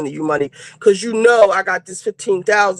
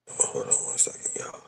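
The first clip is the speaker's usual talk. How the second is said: soft whispered aside; 16 dB quieter than usual. Real, not acted. Whispering to viewers while out on an errand. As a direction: whisper it so nobody nearby hears.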